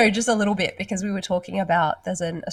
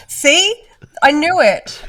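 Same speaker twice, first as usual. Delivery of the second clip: high, loud "gotcha" delivery; triumphant outburst; 7 semitones higher, 8 dB louder, much slower than usual. Real, not acted. Co-host catches the other slipping into dirty talk, triumphant outburst. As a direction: crow it loudly, pitched way up. Gleeful accusation in banter.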